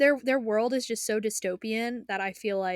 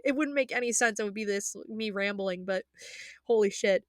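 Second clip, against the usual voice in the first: ordinary talk, nothing marked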